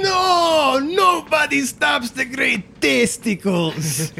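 high, drawn-out theatrical shout; hammed-up boastful warrior's cry, slowing down; Testicles cursing. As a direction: wail defiance as you plummet away. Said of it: "you hear him cursing".

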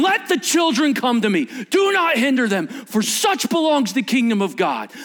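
loud, indignant rebuke. Acted. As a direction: thunder it like a furious teacher defending kids. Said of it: "I can't do indignant good enough".

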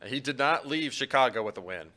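ordinary talk, nothing marked

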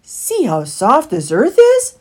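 loud, high and swooping; excited exclamation. A narrator's reading. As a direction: exclaim at a sudden discovery. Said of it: "exclaimed Heathly".

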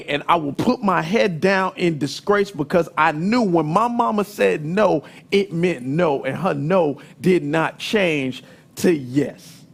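ordinary talk

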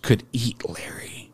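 unvoiced, slow whisper. Real, not acted. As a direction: breathe it out as a whisper. Reading news quote.

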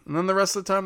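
ordinary talk

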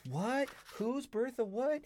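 high, slow rising questions; mock confusion. Retelling being lost later in the book, mock bewilderment. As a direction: lift every phrase into a baffled squeak.

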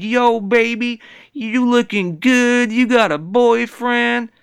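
high, loud, slow catcall voice. Retold or imitated. Guy catcalling a lady in the stairwell, high and slow. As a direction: slide in with a slow loud pickup line. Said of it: "kinda catcalled at a lady and said".

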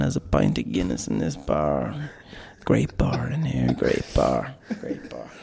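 [name] impression. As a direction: croon it low like [name]. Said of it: "you do [name]".

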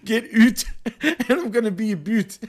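S1 echoes the Canadian line through laughter; Canadian accent. Retold or imitated. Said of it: "he goes".

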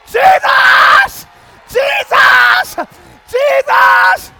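loud, high cries of Jesus; ecstatic shout. Real, not acted. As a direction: cry out the name with all you have. Preacher shouting the name.

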